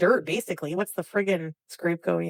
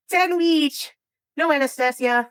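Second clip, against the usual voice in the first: "Sandwich" as "sand-weech", higher, in mock Arabic accent; 7 semitones higher, 3 dB louder, slower than usual. Shown as a demonstration. Streamer showing chat sandwich in Arabic, mock Arabic accent. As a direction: put on an Arabic accent, pitched up. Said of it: "how to say sandwich in Arabic".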